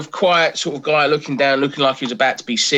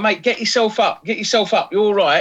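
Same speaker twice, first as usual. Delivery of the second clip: raised-pitch retold urging; 8 semitones higher than usual. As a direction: call back to rouse a fallen passenger. Driver calling back to fallen passenger.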